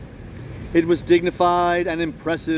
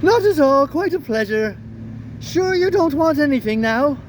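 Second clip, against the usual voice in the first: much higher, silky feline voice; the cat, smooth and mocking; 8 semitones higher than usual. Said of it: "That's my cat voice".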